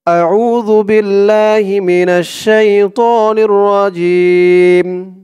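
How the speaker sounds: slow chanted Arabic recitation; Quran recitation. Shown as a demonstration. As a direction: intone it slowly like tajweed.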